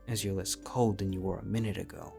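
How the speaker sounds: ordinary talk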